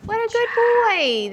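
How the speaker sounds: high, drawn-out praise; high slow praise. Real, not acted. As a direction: stretch the praise out sweetly. Praising dog after the shot.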